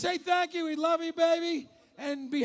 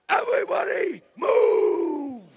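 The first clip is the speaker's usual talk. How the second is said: booming giant voice, louder; Andre the Giant; 7 dB louder, slower than usual. Retold or imitated. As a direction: bellow it like a gentle giant. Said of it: "When Andre the Giant says".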